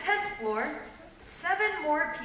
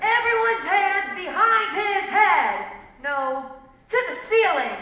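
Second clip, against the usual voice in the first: loud, raised, commanding voice; bandit's order; 7 semitones higher, 9 dB louder than usual. A narrator's reading. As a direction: order the room about like a robber. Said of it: "ordered the bandit".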